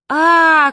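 long, high, loud "aaah"; drawn-out scream of someone falling. A narrator's reading. Mother falling towards the water, long high scream. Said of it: "кричала мама".

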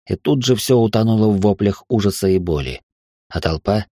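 usual speaking voice

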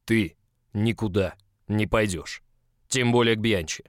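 slow, loud growled order; angry growl. A narrator's reading. Boss forbidding his employee to go to Bianchi, growling. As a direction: growl it out, word by word. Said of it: "ещё раз прорычал".

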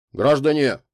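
high, loud, nervous call; trembling voice. A narrator's reading. Толстяк addresses the crowd outside, trembling. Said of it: "голос его дрожал".

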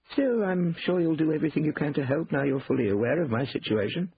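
polite but pointed sign-off; Cornelius, knowing. A narrator's reading. Brother ends the call with gentle pressure, knowing courtesy.

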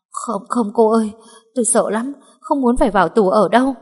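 begging not to go to jail; terrified. Acted. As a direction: maid answers her mistress in frightened pleading. Maid terrified of prison.

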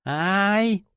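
high, drawn-out yelp; quiet pained howl. A narrator's reading. Fanatic clutching his bleeding hand, high pained yelp. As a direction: whine "ow" in pain. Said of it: "тихонько взвыл от боли".

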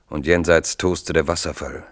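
ordinary talk

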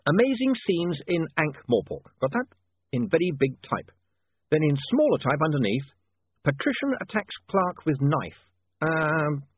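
high, deliberate dictation to a typesetter; thinking out loud in bold headline style; William dictating headlines. Acted. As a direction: compose it aloud, drawing out the headlines.

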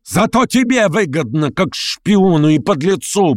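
high-pitched, drawn-out angry cry; shouted insult. A narrator's reading. Shatov shouts back at Verkhovensky from the doorway, angrily. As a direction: fling the insult back from the door. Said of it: "прокричал ему в дверях Шатов".

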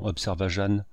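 usual speaking voice

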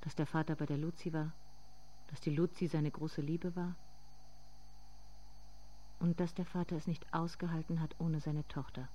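soft, intimate narrating voice; softly spoken inner monologue; hushed story narration. A narrator's reading.

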